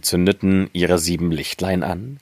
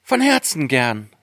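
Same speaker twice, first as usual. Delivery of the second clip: put-on lightly raised girlish voice; a touch of eager agreement, higher; 6 semitones higher than usual. A narrator's reading. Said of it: "sagte Schneewittchen".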